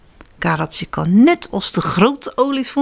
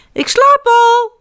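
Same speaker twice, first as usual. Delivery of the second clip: put-on much higher, louder, slow child call; 7 dB louder, slower than usual. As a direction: shout it proudly from bed. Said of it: "roept hij". (kleine beer roept luid vanuit bed)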